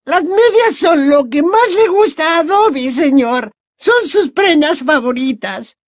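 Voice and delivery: very high, loud squeaky elf delight; excited elf. A narrator's reading. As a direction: gush in a tiny elf squeak. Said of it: "Dobby estaba emocionado". (elfo emocionado, voz chillona y muy aguda)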